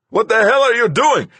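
panicked furious yell. Acted. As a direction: scream it in terror. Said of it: "he yelled".

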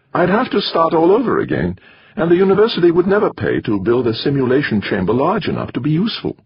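put-on high, pushy foreign-accented retort; countering, accented. A narrator's reading. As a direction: Torok countering Doreen's idea in his accent. Torok continuing.

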